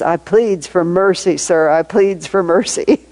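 slow Southern accent, pleading, lightly voiced; a hint of a Southern criminal begging the court, drawn out. Criminal begging the judge for mercy, in a lightly put-on slow Southern accent. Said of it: "a little bit of a Southerner accent".

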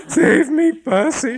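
panicked wife begging her husband; gasped plea. A narrator's reading. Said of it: "she gasped".

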